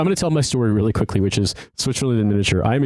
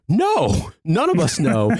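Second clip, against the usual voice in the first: exasperated disbelief; 7 semitones higher, much slower than usual. Retold or imitated. Exasperated reply to security guard.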